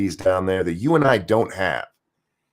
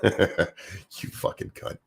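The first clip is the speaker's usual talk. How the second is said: slow, breathy whispered insult through a laugh; under the breath; 3 semitones lower, 9 dB quieter, much slower than usual. Real, not acted. Reacting alone to Warren's tweet, slow whispered curse. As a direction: mutter it to yourself, barely voiced.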